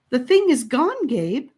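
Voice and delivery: put-on high, insistent girl pushing back; arguing girl. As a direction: overrule his fear, loud and high. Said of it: "Annika argued with him".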